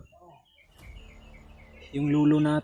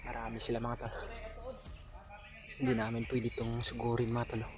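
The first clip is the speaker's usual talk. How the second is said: whispering while hiding; 15 dB quieter than usual. Real, not acted. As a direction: speak softly so nobody hears. Hiding from enemies, quiet.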